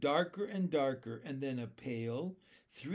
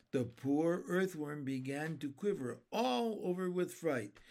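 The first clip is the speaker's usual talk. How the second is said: ordinary delivery, nothing marked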